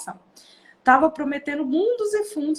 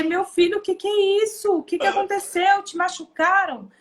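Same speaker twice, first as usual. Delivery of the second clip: higher-pitched, startled mother; retold alarm, pitched up in questions; alarmed questioning; 6 semitones higher than usual. Mother asking her screaming son if he was hurt, alarmed.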